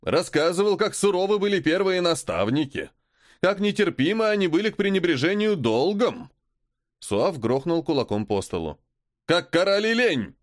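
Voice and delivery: furious lord. Acted. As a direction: pound the table, shouting. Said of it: "всё громче говорящего Суава".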